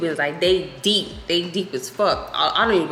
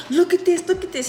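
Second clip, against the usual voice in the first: mock upset owner; 11 semitones higher than usual. Angry owner pointing out damage.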